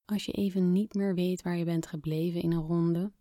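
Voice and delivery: ordinary delivery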